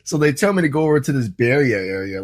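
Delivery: normal talking voice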